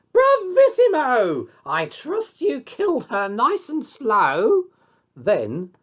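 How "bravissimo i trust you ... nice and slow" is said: very high, loud gloating queen; gleeful cry. A narrator's reading. Queen gloating over the huntsman's news, high glee. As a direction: crow with cruel delight. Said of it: "The queen cried out".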